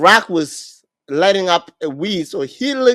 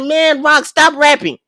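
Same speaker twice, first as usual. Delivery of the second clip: retold yell; 8 semitones higher, 4 dB louder than usual. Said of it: "Twerk started yelling".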